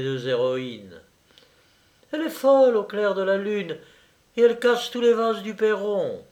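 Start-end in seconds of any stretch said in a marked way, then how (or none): 2.1-6.2 put-on high-pitched young lady; exasperated girlish complaint, pitched up; complaining young girl. A narrator's reading. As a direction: voice a girl fussing over her dog. Younger girl complains about the dog.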